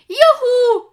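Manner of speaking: high, loud whoop of joy; jubilant cheer. Acted. Young Viking cheering the retreat, high whoop. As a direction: cheer out loud like you've won. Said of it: "jubelte Gorm".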